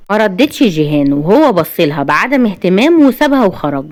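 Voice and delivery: plain reading voice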